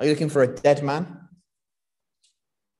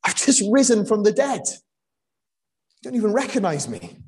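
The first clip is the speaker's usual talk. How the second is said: exasperated Jesus; 9 semitones higher, 3 dB louder than usual. Pretend. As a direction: play the impatient friend he never was. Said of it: "He doesn't say".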